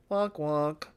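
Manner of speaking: two-note descending "wonk wonk"; mock sad trombone. Streamer reacting to the boat being gone, mock sad trombone. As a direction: imitate a comic failure horn.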